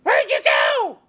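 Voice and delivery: loud shout ending in a rise; panicked shout. Acted. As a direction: yell into the empty woods. Hermia searching in panic.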